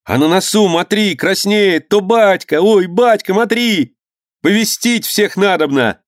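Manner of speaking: put-on very high boyish cries; excited shouting. Boys see father on the bow, excited shouting. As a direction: point it out in breathless excitement.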